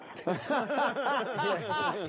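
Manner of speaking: high burst of laughter; bubbling, high-pitched laughing. Real, not acted. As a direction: crack up at the punchline. Laughs at the punchline.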